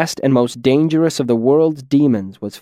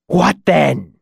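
higher, hissing demon rasp; hissed rasp; 4 semitones higher than usual. A narrator's reading. Bound demon answering his captor, hissing rasp. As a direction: rasp it like stone grating on stone. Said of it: "Incanus hissed, his voice like the rasp of stone on stone".

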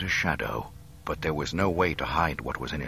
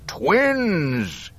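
high, loud villain's roar; triumphant roar; 11 semitones higher, 8 dB louder than usual. A narrator's reading. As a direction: roar out your discovery in triumph. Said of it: "he roared triumphantly".

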